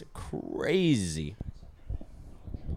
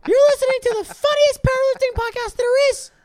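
very high, loud squeaky cartoon voice; Mickey Mouse from South Park; 10 dB louder than usual. Plugging the powerlifting podcast as a piercingly high, loud cartoon mouse. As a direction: blare it out in cartoon falsetto. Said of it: "It's like Mickey Mouse from South Park".

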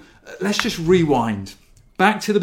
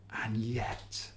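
whisper; 3 semitones lower, 12 dB quieter than usual. Real, not acted. Hushed turn in sermon.

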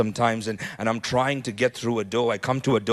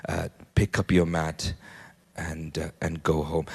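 hushed storytelling; 4 semitones lower, 6 dB quieter than usual. A narrator's reading. Quiet hushed retelling of the story.

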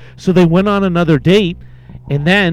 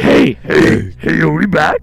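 Cookie Monster voice; slower than usual. Acted. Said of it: "goes from like Cookie Monster".

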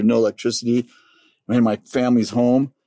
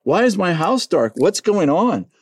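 bewildered; 8 semitones higher than usual. Retold or imitated. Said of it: "I'm like".

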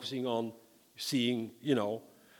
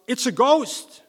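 terrified cry; 10 semitones higher, 11 dB louder, slower than usual. Acted. Disciples crying out in fear.

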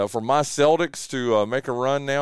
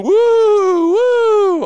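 high, loud ghostly hoot; spooky ghost woo; 11 dB louder than usual. Pretend. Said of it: "Are you scared?".

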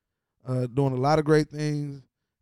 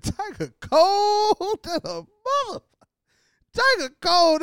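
laughing exclaim. Real, not acted. Laughing at Tiger's trick.

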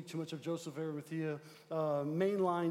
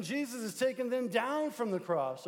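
usual speaking voice

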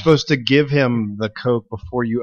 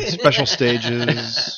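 slow mock announcer; much slower than usual.

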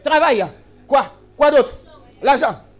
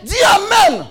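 higher, raised preaching cry, each line driven home; rally the church like a fired-up pastor; preaching at full force; 5 semitones higher than usual. Acted. Pastor urging his congregation to praise God, in a fired-up preaching cry.

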